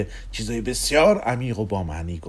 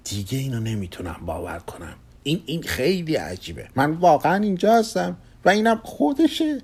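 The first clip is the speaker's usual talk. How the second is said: amazed inner thought; 6 semitones higher than usual. Acted. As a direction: start hushed, rise in wonder. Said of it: "با خود فکر کرد".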